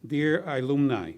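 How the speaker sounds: normal talking voice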